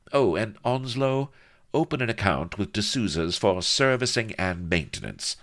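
high, airy instruction; offhand order. A narrator's reading. Mistress instructing her footman over her shoulder, airy. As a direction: toss it over your shoulder to a servant. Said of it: "she added over her shoulder to the footman".

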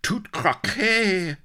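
high, slow French exclamation; French accent. A narrator's reading. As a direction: cry it out in a hammy French accent.

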